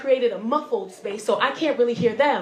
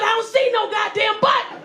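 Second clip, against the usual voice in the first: high, irate comic protest; exasperated shout; 9 semitones higher, 4 dB louder than usual. Acted. Trapped driver argues with rescuer about button, exasperated shout. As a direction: snap back in hammed-up frustration.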